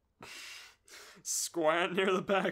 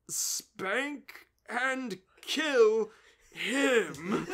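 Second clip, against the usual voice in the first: dragged out very slowly, higher; slow deadpan; 3 semitones higher, 3 dB louder, much slower than usual. Acted. Bob giving the absurd order, very slow. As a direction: stretch every word of the order.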